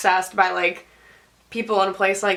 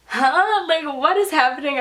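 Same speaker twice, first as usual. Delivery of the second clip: higher-pitched baffled exclamation; mock-stunned, incredulous; retold bewilderment; 6 semitones higher than usual. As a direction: act out total confusion. Retold bewildered reaction to thrown gloves.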